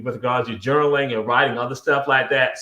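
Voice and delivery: normal talking voice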